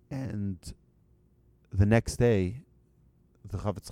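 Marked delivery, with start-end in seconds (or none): none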